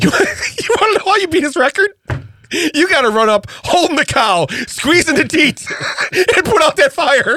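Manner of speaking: high, laughing excited rant. Real, not acted. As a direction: pile it on, laughing harder.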